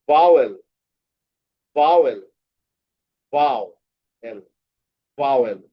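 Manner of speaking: ভাওয়েল repeated, one take stretched slowly; syllable drill. Shown as a demonstration. Teacher drilling class on syllables of ভাওয়েল. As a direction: sound out ভাওয়েল as bha-wel, clapping the beats. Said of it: "দুইবার".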